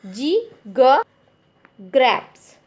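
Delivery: जी ग ग्रेप्स, very slow, ग said as a short ga sound; phonic sound drill. Shown as a demonstration. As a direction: stretch out जी, ग, ग्रेप्स. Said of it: "जी की sound होती है ग". (धीमे स्वर में फोनिक ध्वनि)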